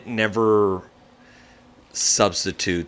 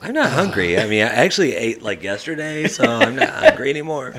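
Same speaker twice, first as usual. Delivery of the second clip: laughing excuse; 7 semitones higher, 3 dB louder than usual. Pretend. Mock excuse for not eating.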